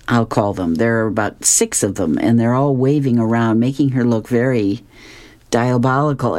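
usual speaking voice

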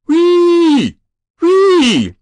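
long high siren "whee"; screaming like an engine. A narrator's reading. Boy on the roof flapping his arms, screaming like an engine. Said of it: "He began screaming like some kind of engine".

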